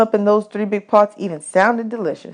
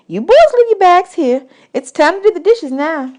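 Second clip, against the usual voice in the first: higher, mock-grand woman's voice; woman at camp; 11 semitones higher, 4 dB louder than usual. Camp woman teasing boys about their tin china, higher. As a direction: serve it like fine china, teasing.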